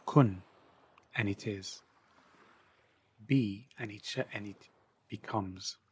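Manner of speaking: measured supplication, firm emphasis on key words; devotional invocation. Acted. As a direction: address God with reverent weight, pausing after each phrase. Recites a meditative invocation.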